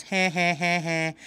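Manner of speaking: short smug heh heh laugh; gloating chuckle. Real, not acted. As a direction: chuckle smugly at the opponent.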